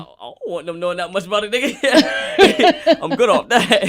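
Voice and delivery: high, loud laughing refusal. Real, not acted. Rapper laughing as he refuses the interviewer's DNA idea.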